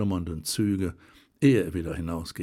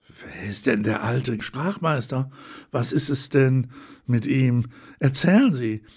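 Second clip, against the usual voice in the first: eager, rising and louder, begging for the story; curious ladies urging him to tell more; eager ladies calling out; 3 semitones higher, 5 dB louder than usual. A narrator's reading. Curious ladies press the old man for his story, eager and louder. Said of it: "riefen die Damen".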